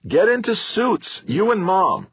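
high, slow, loud shout. Acted. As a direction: drive the order home. Theo urging sister during attack.

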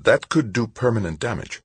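anxious psychologist's warning; hesitant psychologist. A narrator's reading. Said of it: "The psychologist hesitated".

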